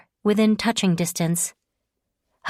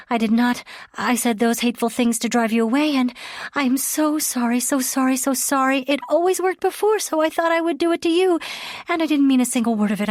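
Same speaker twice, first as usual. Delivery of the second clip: high, pleading, tumbling apology; desperate apology; 7 semitones higher, 3 dB louder than usual. Acted. Leah begging Archer's forgiveness, high and desperate. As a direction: beg him to believe her, words rushing.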